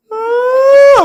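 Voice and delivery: sudden high, loud mock wail; imitated wailing cry. Retelling a student bursting into tears, mock wail. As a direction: burst into a hammed-up sob. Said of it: "하고 우는 거야".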